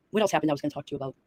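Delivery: usual speaking voice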